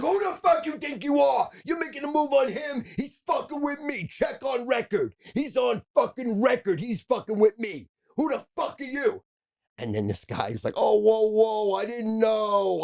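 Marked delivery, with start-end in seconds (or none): 0.0-9.2 high-pitched, retold mobster threat; strained, high confrontational rant; threatening tirade. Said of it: "just threatens the fuck out of this guy". 10.8-12.8 high, loud, drawn-out protest; long, pitched-up whoa of surrender; alarmed backing-off. Retold or imitated. Threatened man backing off in story.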